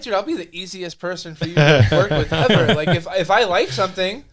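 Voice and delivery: laughing through the words, loud; laughing reassurance. Retold or imitated. Writer laughingly reassures his editor, laughing loudly. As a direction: laugh while promising to be easy. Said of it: "I'm like".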